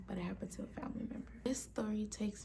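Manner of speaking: hushed spooky whisper; storyteller whisper. Real, not acted. Whispering a creepy story to viewers, hushed whisper.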